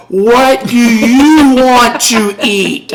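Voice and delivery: slow, loud, over-enunciated question; exaggerated mouthing. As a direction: stretch every word out high and loud. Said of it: "very exaggerated hand motions and mouth".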